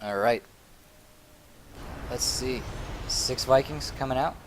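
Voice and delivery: normal talking voice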